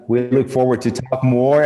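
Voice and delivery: normal talking voice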